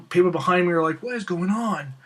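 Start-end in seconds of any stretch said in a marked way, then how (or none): 1.0-1.9 acted-out disbelief; excited bewilderment. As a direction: imitate bystanders marvelling. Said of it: "Laney was flipping out next to him".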